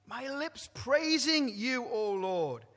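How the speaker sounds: drawn-out proclaiming praise; fervent praise. Real, not acted. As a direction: lift it up to the Lord. Preacher swells in praise.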